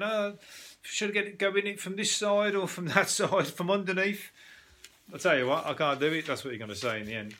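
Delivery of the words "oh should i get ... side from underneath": higher, puzzled musing over each angle; playful mock deliberation, pitched up; pondering eater. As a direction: weigh up the sandwich like a tricky putt. Imagined eater sizing up sandwich.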